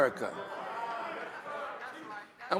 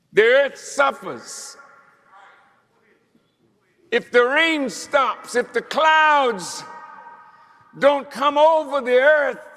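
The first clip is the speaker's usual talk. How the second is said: rising, louder preacher's cry, pressing each line home; preaching at full force; 5 semitones higher, 3 dB louder than usual. Acted. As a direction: preach it hard to the congregation, building to a shout. Preacher building to shouts.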